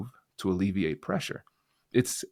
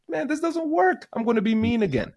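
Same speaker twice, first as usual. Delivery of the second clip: high, exasperated mock complaint; mock frustrated quitter; 13 semitones higher, 5 dB louder than usual. People who tried empathy giving up, in a high mock-exasperated whine. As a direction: whine it like someone who gives up after seven minutes.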